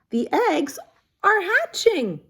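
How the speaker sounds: gleeful Piggy; excited news. Acted. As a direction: tell the good news brightly.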